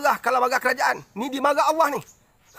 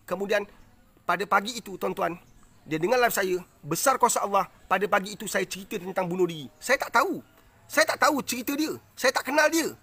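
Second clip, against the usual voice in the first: usual speaking voice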